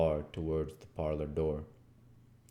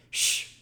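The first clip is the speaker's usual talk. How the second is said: sharp shush; 4 dB louder than usual. Acted. Hushing the others.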